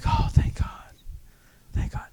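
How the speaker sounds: breathy mock relief in a whisper. Recruit when the noise finally stopped, whispered relief. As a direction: let it out as a hushed sigh.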